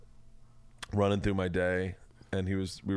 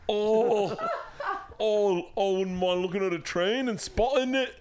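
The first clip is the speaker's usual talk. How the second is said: put-on Scottish accent; 10 semitones higher than usual. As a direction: ham up a clumsy Scottish accent. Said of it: "This is more Scottish".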